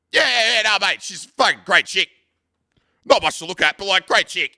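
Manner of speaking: loud, high Aussie blokey voice imitating a mate; classic Australian mate. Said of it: "classic Australian".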